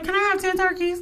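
high-pitched hopeful question; tentative retold ask. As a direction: ask it up high, as if testing your luck. Retells own tentative ask for turkeys.

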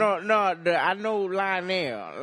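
loud, slow boastful uncle; drawn-out uncle bragging; booming tipsy uncle. Pretend. Uncle insists he knows Lionel.

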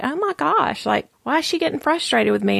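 high, quick, dismayed inner voice; taken aback. Retold or imitated. Wondering why her friend is annoyed, taken aback.

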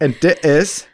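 hammed-up British show intro; mock British accent. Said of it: "my British accent is far better than yours".

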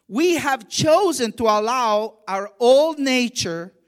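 rising sermon voice, pushing the key lines out loud; preaching. Acted. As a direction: preach it to the congregation with conviction. Preaches to the congregation.

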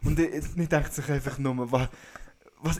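ordinary delivery

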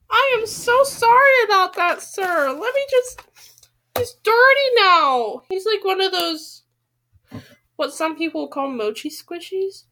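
high, overly polite fussing voice; mock-formal apology. Reader apologizes to the dirty turtle toy as sir. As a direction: fawn over the toy like a butler.

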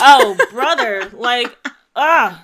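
slow, loud exasperated groan. Real, not acted. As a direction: drag it out, fed up. Exasperated reaction.

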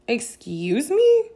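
drawn-out, high, loud rising "Excuse me?"; affronted question. Retold or imitated. Reacting to her running the conversation, affronted. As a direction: stretch it slowly upward in offended disbelief.

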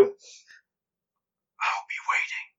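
a slow menacing whisper up close; eerie whisper. Acted. GM as dream figure whispering nose to nose, slow eerie whisper. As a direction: breathe it slow and unvoiced, right in their face.

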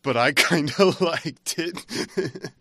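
sheepish laugh breaking up the words; laughing confession. Real, not acted. Embarrassed confession of liking a bad film.

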